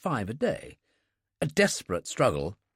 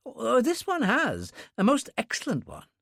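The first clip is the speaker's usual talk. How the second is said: much higher, gushing delight; a young fan of the author; enthusiastic clerk; 11 semitones higher than usual. Acted.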